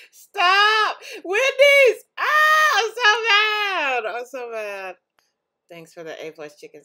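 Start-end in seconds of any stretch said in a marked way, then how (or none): 0.1-2.8 high, loud tantrum yell; hammed-up outburst; mock rage shout. Acting out an angry meme. 2.8-4.0 high loud drawn-out tantrum cry, slowed; hammed-up crying shout; mock furious wail. Acting out an angry meme.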